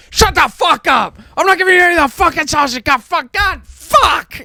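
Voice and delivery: high, furious shout; raging dad. Retold or imitated. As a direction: imitate a dad flying off the handle. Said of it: "my dad's just raging".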